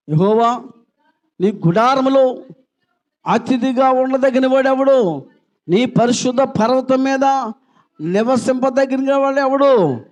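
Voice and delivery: slow, high, loud psalm reading; slow, ringing Bible reading; solemn scripture reading. Acted. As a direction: intone the psalm slowly to the church.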